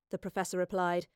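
usual speaking voice

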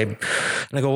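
ordinary delivery

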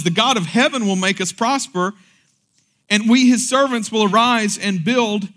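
bold, ringing declaration of faith; defiant proclamation. Acted. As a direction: proclaim it like a battle cry. Reading Nehemiah's defiant reply.

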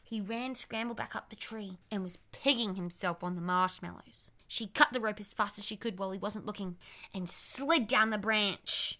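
ordinary delivery, nothing marked

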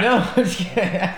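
laughing through the words; breaking into a laugh while admitting it. Real, not acted.

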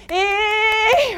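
high, loud mock "ê" to end the prayer; imitated "ê" call. Mother imitating Ayla's mealtime "ê", high and loud. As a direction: squeal the "ê" like the girl does. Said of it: "por causa do ê".

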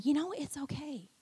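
soft, quiet reassurance; gentle whisper. Retold or imitated. God comforting weary pastor, soft reassurance. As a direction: breathe it tenderly. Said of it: "God was just whispering to me".

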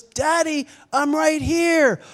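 put-on high, drawn-out child's call; little girl calling. Young daughter answering her dad from afar, high call. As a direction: sing out like a small child.

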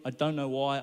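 usual speaking voice

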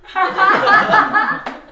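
very high, squealing laugh. Real, not acted. Laughing at the memory of her friend, high laugh. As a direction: burst into a high giggle. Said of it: "it's so funny".

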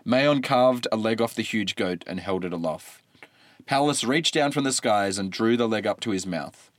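ordinary delivery

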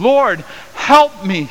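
high, loud and slow, begging; desperate plea. Acted. Kneeling woman to Jesus, a high loud slow desperate plea. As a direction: cry out for help, drawn out. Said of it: "she said".

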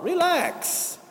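"Relax." pitched high and falling, exasperated; exclaimed relax. Acted. Mocking ego, telling it calm down.

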